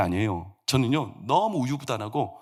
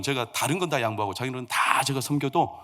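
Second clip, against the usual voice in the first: ordinary talk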